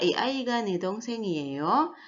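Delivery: slow Korean question, "dongsengiyeyo" climbing at the end; rising question ending. Shown as a demonstration. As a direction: stretch it and sweep the end of "dongsengiyeyo" upward. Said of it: "akhirannya intonasinya juga menaik".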